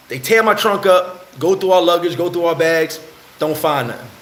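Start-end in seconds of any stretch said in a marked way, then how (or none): none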